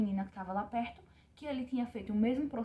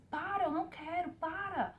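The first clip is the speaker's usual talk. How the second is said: higher pleading cry to stop; pleading protest; 8 semitones higher, slower than usual. Retold or imitated. Mother in labour begging the doctor to stop, high pleading cry. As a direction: plead with him to stop, voice pitched up. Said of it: "e disse".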